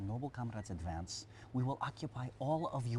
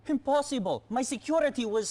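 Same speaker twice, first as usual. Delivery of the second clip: loud, high, disbelieving cry; panicked high shout; shocked outburst; 14 semitones higher, 9 dB louder than usual. Acted. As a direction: blurt it out in alarm. General shocked by news.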